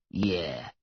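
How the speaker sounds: put-on low, deep voice of an old smoker; deep smoker's voice. A narrator's reading. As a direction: sink into a deep smoky voice. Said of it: "in her deep smoker's voice".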